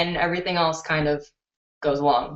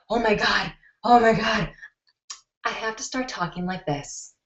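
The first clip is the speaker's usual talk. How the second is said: a put-on voice, much softer on the last line; lightly altered YouTube voice; altered voice demo; 6 dB quieter than usual. Shown as a demonstration. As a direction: show the fake voice she could use. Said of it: "I can alter my voice like this".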